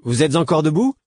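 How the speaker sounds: high, loud, barely controlled call; a drunk young man calling through the door; unsteady voice. A narrator's reading. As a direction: voice wobbling out of control. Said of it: "d'une voix mal maîtrisée".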